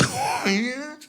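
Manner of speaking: high delighted giggle. Retold or imitated. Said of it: "I was like".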